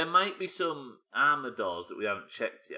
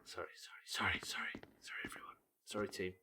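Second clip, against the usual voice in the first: whisper; 10 dB quieter than usual. Real, not acted. Apologising quietly to the team.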